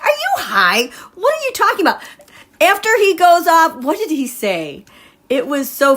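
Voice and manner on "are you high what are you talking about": higher, mock-baffled retort; incredulous disbelief. Mocking a senator's remarks, incredulous and high. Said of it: "I'm like".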